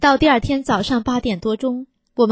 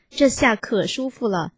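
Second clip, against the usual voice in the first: usual speaking voice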